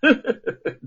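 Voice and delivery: high, loud mocking cackle; cold sneering laugh. A narrator's reading. Jailer scoffing at the prisoner's defiance, cold laugh. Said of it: "牢监冷笑着说".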